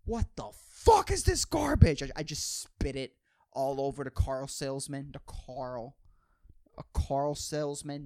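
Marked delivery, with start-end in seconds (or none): 0.0-2.0 high, loud mock tantrum; hammed-up high furious yell; pretend outraged customer. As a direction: shriek it like a demanding customer spitting coffee. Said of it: "the second that I fucking sip it".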